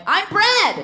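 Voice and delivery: "I'm bread!" shouted high and loud; proud shout. Retold or imitated. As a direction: announce "I'm bread!" triumphantly. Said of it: "and I'm like".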